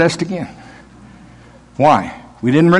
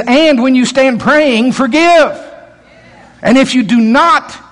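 slow, raised preaching voice; a preacher's solemn quote; 7 semitones higher, slower than usual. Acted. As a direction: lift it up slow like scripture. Preacher quoting Jesus.

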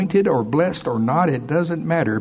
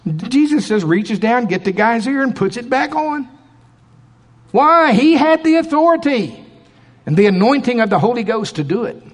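preaching; 8 semitones higher than usual. Acted. Preaching at full force.

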